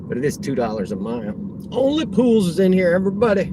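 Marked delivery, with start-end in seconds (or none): 1.7-3.5 big, high, loud welcome; loud, high-pitched greeting to a viewer in chat; excited shout-out. Real, not acted. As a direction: hype up the new arrival. Streamer greets a new chat viewer.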